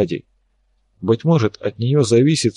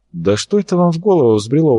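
higher-pitched indignant protest; taken aback and protesting; 6 semitones higher than usual. A narrator's reading. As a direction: object with mild outrage. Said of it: "возмутился Арцулов".

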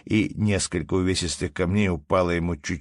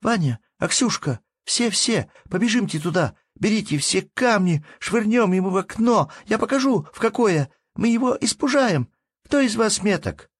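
high, loud urgent commands; rally the children to the attack; little girl giving orders; 4 dB louder than usual. Acted.

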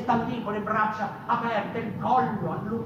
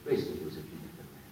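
hushed fast ending; 8 dB quieter, faster than usual. Acted. Soft closing of the speech.

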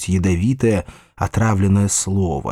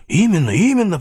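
higher, emphatic agreement; keen, high-pitched assent; eager agreement; 9 semitones higher than usual. A narrator's reading. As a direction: jump in eagerly. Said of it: "подхватил дядя".

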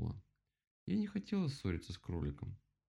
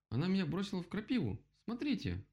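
high-pitched outraged exclamation; exclaiming; 11 semitones higher than usual. A narrator's reading. Rabbit shows off his nettle burn, exclaiming. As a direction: complain high and dramatic. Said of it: "воскликнул кролик".

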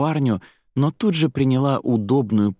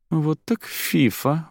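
slow, higher-pitched smirk; 7 semitones higher, slower than usual. A narrator's reading. Zaira smirks at the choosy newcomer, drawn-out sneer. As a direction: draw it out with a sneer. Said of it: "ухмыльнулась Заира".

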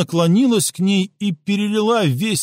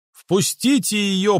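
raised, slow group cry; shouting; 5 semitones higher, slower than usual. A narrator's reading. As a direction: call it out together, firmly. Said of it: "прокричали полёвки".

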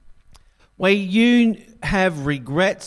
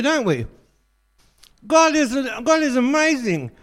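usual speaking voice

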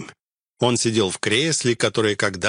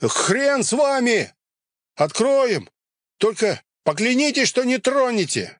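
shouting; 12 semitones higher than usual. Acted. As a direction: yell back through the bars. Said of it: "один закричал".

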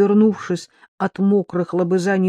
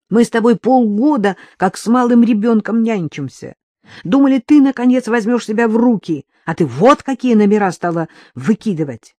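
raised, higher-pitched reproach; indignant scolding; 4 semitones higher, 5 dB louder than usual. Acted. Daughter scolds mother after half a year of nursing, indignant. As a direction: let the pent-up complaint burst out.

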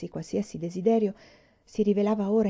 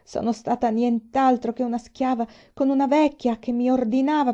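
distraught, higher and louder outpouring, no real sobs; sobbing; 5 semitones higher, 6 dB louder than usual. A narrator's reading. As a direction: pour out the complaint as if through tears. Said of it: "scossa dai singulti".

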